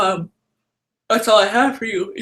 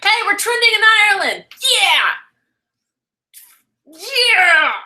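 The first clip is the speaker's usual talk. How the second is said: high, loud, fast celebration; excited cheer; 10 semitones higher, 4 dB louder than usual. Real, not acted. Streamer cheering viewers over trending in Ireland, excited and loud. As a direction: whoop it up, thrilled.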